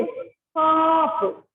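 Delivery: सांप drawn out slowly, nasal on सा; slow nasal demo. Shown as a demonstration. Teacher showing students how to say सांप, slowly. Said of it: "क्या बोलेंगे?".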